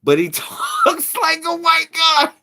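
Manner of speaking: high squeaky mock tourist voice, laughing; squeaky high tourist quote with a laugh; mock shocked tourist. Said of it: "They're like".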